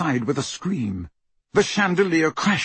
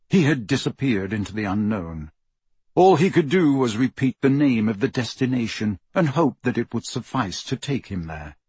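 plain reading voice

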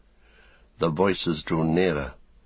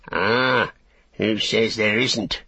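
orc tracker; 3 semitones higher, 3 dB louder, slower than usual. Acted. Tracker retorts.

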